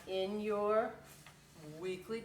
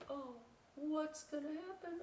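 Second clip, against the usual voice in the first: higher, softer wondering; eager raccoon; 9 semitones higher, 6 dB quieter than usual. Retold or imitated. Little raccoon eager about the story, higher voice. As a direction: lean in wanting more. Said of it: "the little raccoon's like".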